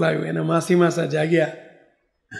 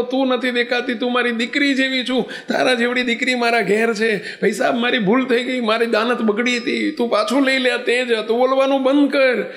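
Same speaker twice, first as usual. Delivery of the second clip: higher, frantic begging for mercy; desperate pleading; 6 semitones higher than usual. Acted. Blinded rickshaw driver begging the girl to stop chanting, panicked. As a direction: beg her to stop, panicked and sorry.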